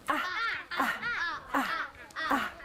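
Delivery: light laughing ah ah; repeated ah. Shown as a demonstration. Teacher showing the ant sound with a laugh. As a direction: bounce out the ahs playfully. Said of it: "I'll show you the sound".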